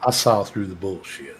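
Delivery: bullshit drawn out slowly, low and soft; slow Texas twang. Shown as a demonstration. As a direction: stretch out bullshit with a country twang. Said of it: "say bullshit really slow for me one more time".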